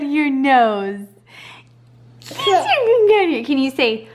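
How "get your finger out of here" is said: high, loud playful scold; mock scolding. Real, not acted. Scolding baby's nose-picking.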